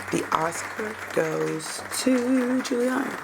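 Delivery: slow, grand Oscar announcer voice; drawn out like an awards host; mock awards announcer. Host hailing Juliana's acting, mock award announcer.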